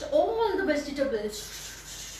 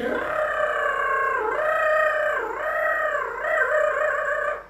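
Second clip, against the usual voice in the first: mixer grinding sound; 13 semitones higher, 4 dB louder than usual. Acted. Grinding noise imitated.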